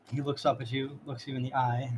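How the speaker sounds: ordinary delivery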